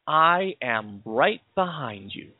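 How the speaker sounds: slow, drawn-out words of support; emphatic reassurance. Retold or imitated. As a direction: stretch it out so the promise sinks in. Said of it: "Do you see what he's saying?".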